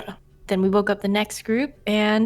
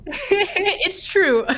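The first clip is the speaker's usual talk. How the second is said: laughing; 9 semitones higher than usual. Real, not acted. Laughing after joke.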